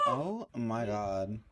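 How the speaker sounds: slow, low dog voice. Retold or imitated. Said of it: "He said".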